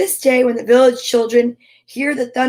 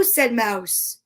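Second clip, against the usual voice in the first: ordinary delivery, nothing marked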